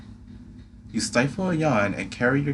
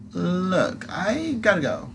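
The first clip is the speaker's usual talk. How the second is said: high squeaky moth creature; pixie; 8 semitones higher, slower than usual. Acted. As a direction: tease in a tiny squeaky voice. Atlas voice.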